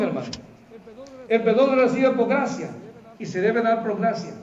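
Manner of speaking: normal talking voice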